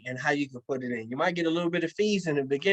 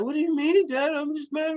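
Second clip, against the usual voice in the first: put-on high, whiny voice of a sulky rich kid; whiny son; 10 semitones higher than usual. A spoiled son whining back at his dad in the limo, high and whiny.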